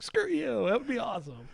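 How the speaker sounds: high-pitched, playful comeback; mock defiant retort. Designer joking back at imagined angry players, mock defiant.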